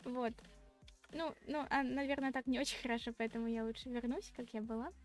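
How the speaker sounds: ordinary delivery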